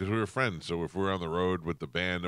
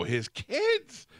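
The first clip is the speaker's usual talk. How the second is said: high indignant squeak; outraged disbelief; 4 dB louder than usual. Retold or imitated. Outraged retold reply.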